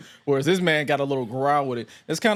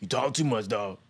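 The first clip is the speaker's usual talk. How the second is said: wrestler promo voice. Retold or imitated. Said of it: "He gonna be like".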